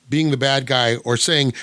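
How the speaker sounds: normal talking voice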